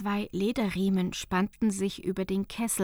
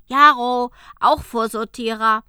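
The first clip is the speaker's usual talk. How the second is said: much louder, bright elf; 2 semitones higher, 15 dB louder than usual. Acted. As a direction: announce your name proudly. Second elf introduces himself. (Elf Jaro stellt sich laut vor)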